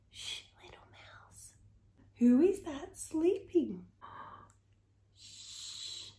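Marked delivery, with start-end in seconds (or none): 0.1-1.5 hushed shh to a little mouse; library whisper. A narrator's reading. 4.0-6.1 library whisper. A narrator's reading. Gasp then hush.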